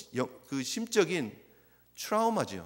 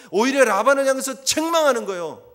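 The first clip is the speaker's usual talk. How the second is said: louder, higher preaching cry; forceful preaching; 6 semitones higher, 9 dB louder than usual. Real, not acted. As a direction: drive the word 책망하는 home. Preaching climax.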